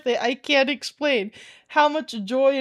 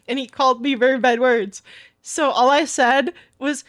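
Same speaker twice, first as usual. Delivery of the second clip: ordinary talk, nothing marked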